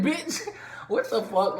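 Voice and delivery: high, loud outburst of disbelief; shocked reaction. Retold or imitated. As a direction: blurt it out, stunned. Shocked reaction to Jackie's reveal.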